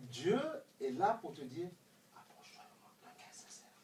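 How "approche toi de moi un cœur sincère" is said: hushed whisper, no voice at all; whispered call. Acted. God calling the believer close, soft whisper. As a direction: breathe it like a secret invitation.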